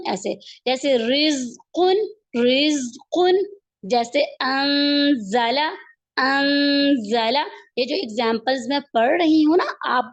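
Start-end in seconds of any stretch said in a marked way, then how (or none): 2.4-7.4 انزل drawn out very slowly, ز hissed; slow whistled ز. Shown as a demonstration. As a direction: stretch each word slowly with a sharp ز. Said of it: "سیٹی کی آواز آنی چاہیے".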